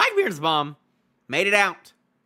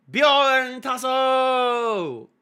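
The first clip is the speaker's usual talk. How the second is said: high, long drawn-out attack shout; slow, ringing anime-style yell; battle cry; 8 semitones higher, 4 dB louder, much slower than usual. Acted. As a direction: call out your special move, stretching every syllable. Calls out an attack name, reading.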